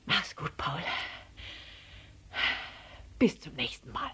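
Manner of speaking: soft, breathy goodbye; breathless farewell. A narrator's reading. Elephant saying goodbye to Paul, breathless. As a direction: say goodbye softly between breaths. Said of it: "jappte er".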